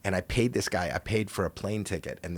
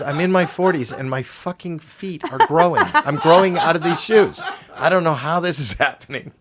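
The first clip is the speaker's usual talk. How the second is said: loud, high-pitched imitation of exasperation; hammed-up disbelief, voice pitched way up; mock bewildered outrage; 10 semitones higher, 9 dB louder than usual. Comic plays Lou baffled by growing feet, mock outraged.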